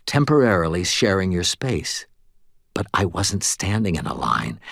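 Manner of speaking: ordinary delivery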